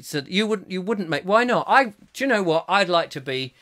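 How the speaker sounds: normal talking voice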